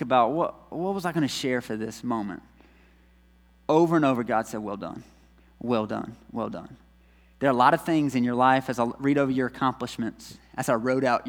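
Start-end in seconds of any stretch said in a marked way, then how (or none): 4.6-6.8 soft, lower repeated well done; hushed tender praise; tender whisper. Acted. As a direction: say it gently, like a blessing. God's quiet repeated praise.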